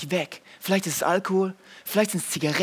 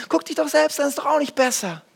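put-on kids' retort; 9 semitones higher, 4 dB louder than usual. Children quoted mocking parent.

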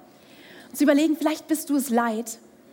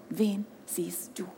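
slow whisper, much softer; whispered question; 6 semitones lower, 10 dB quieter, slower than usual. Acted. Preacher whispers the repeated question to the crowd.

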